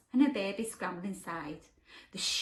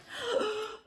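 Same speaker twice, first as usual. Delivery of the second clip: shocked gasp; 12 semitones higher than usual. Acted. George realises trousers fall.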